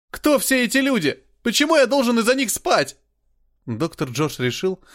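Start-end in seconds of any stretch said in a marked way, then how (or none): 0.1-3.0 high, loud, panicked questions; frightened shouting. A narrator's reading. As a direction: cry out in panic. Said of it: "закричал Билли, снова встревожась".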